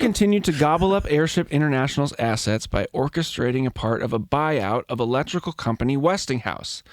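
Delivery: ordinary delivery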